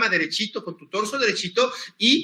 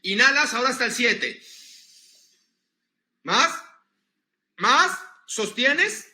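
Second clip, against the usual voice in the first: guided breathing cues; 3 semitones higher than usual. Shown as a demonstration. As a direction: lead the breath, pausing long between commands. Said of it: "hazlo conmigo".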